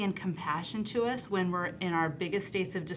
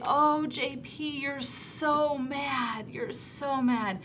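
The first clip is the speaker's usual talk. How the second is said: slow, soft and tender, fading to a hush; slow comforting voice sinking into a whisper; soothing empathy; 5 semitones higher, 4 dB quieter, much slower than usual. Retold or imitated. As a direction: soothe an angry child gently. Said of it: "And so I say".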